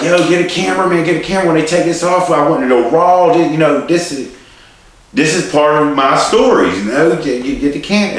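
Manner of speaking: high, loud, urgent call for a camera; excited yelling. Retold or imitated. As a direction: shout from the stretcher, hyped and hurting. Retold urging for a camera again.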